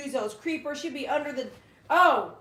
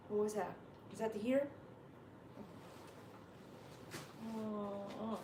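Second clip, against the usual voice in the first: quiet aside; 2 semitones lower, 13 dB quieter, faster than usual. Real, not acted. Coaxing the dog to put her head down, quietly. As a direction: speak softly, off to the side.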